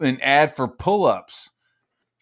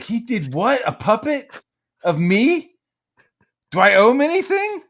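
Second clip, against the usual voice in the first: high, loud, excited disbelief; hammed-up thrilled at a puppet of himself; mock excited reaction; 12 semitones higher, 6 dB louder than usual. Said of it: "I'd be like".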